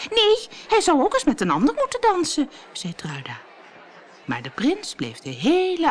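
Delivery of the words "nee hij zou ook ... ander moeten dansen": high, loud jealous protest; indignant complaint. A narrator's reading. Jealous stepsister complaining about the prince's dancing, indignant. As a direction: protest loudly at the injustice.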